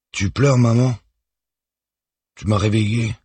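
put-on a small boy woken in the night; lightly voiced little boy; child voice. A narrator's reading. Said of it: "son fils".

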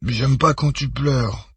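put-on high, slow child's whine; drawn-out little boy's plea; whining child. A narrator's reading. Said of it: "gémit Victor".

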